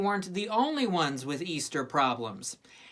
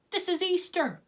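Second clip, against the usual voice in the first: insisting, high and earnest; 9 semitones higher than usual. Acted. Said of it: "he insisted".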